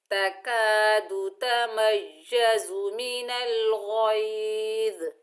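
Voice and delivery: slow, melodic tajweed recitation; Quranic recitation. Shown as a demonstration. Teacher reciting the next verse slowly. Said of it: "next पढ़िए".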